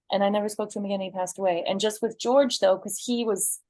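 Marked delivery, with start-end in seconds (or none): none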